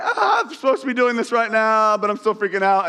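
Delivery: playful high-pitched freak-out, easing down; mock panic. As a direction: wail it like a terrified rider, hammed up. Voicing screaming rider in photo.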